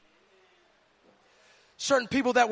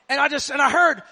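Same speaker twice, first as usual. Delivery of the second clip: normal talking voice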